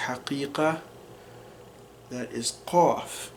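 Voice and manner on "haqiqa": "haqiqa" breathed, no voice; whispered. Shown as a demonstration. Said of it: "if you say the word reality".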